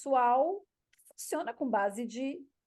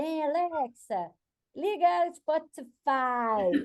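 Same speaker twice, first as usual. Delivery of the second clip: fed-up husband; 5 semitones higher, much slower than usual. Retold or imitated. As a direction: imitate the husband snapping at the assistant, very slow. (marido revoltado com a Alexa, devagar e mais alto)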